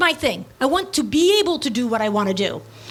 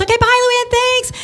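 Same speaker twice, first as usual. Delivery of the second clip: high, louder imitation of an interviewer signing off; chirpy goodbye; 11 semitones higher, 5 dB louder than usual. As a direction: sing out a breezy farewell. Acting interviewer's quick goodbye.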